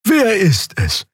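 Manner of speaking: high, slow creature voice; curious Draxül. A narrator's reading. Xea asks who the customer is, curious Draxül voice. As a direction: put on a high, drawn-out creature voice.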